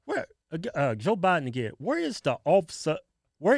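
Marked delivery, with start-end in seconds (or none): none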